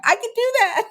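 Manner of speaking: very high, bright eager reply; eager yes. Retold or imitated. Answering her friend's challenge, high eager agreement. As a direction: leap at the idea.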